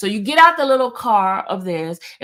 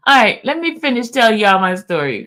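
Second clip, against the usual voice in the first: usual speaking voice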